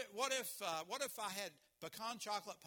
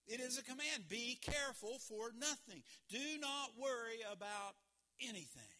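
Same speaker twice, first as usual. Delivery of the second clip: usual speaking voice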